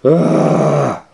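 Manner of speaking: loud, high exasperated "ah"; frustrated cry. A narrator's reading. Peddler bursts out at the monkeys, loud frustrated cry.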